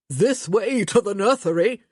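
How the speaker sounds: laughing tease. A narrator's reading. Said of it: "Gregory laughed".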